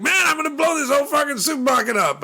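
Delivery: high, fast, yelled threat; mock action-hero shout. Quoting a movie villain to a friend, high fast mock shout. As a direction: bark it like a hammy movie villain. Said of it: "he's like".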